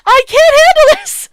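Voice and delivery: loud, high cry of despair; character breaking down in a loud wail; overwhelmed outburst. Acted. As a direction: scream it out at the end of your rope. Repeated as outburst.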